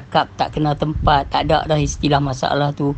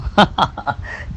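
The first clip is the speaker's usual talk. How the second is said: breathy laugh. Real, not acted. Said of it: "Tapi hang pula pergi kata aku marah". (ketawa kecil tak percaya)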